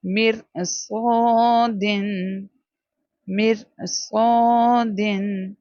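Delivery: slow مر صادن with a full heavy saad. Shown as a demonstration. Teacher drilling heavy letters, slow heavy saad. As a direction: draw out صادن heavy and thick. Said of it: "موٹا کر کے پڑھیں گے".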